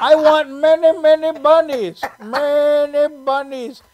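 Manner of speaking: put-on loud, drawn-out Indian accent. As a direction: stretch it out in a high Indian accent. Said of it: "I love doing that accent".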